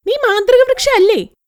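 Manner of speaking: high, eager voice asking the magic tree; greedy young woman. Acted. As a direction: pitch it up as the demanding girl. Greedy girl asks the tree. (ഉയർന്ന സ്വരത്തിൽ ആർത്തിയുള്ള പെൺകുട്ടി)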